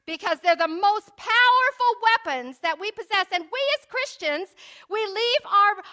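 loud, high revival preaching; fervent preaching. Acted. Preacher exhorts brothers and sisters, loud fervent preaching. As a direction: hammer home the weapons of faith.

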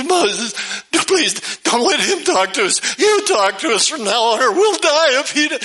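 high, hammed-up panicked pleading; scared to death. Frightened people begging Moses to speak for God, acted panic. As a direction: plead in a high mock panic, climbing as it goes. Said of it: "the people were scared to death".

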